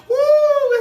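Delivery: high, loud, gleeful "woo"; a quick hammed-up cheer; mock whoop. Imitating a churchgoer whooping over his check.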